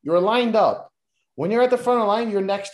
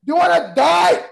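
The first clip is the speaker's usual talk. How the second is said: slow, loud, higher hammed-up yelling; mock threatening shout; 6 semitones higher, 3 dB louder than usual. Podcaster shouting a mock threat at a wrestler. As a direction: yell it like a mock heel promo.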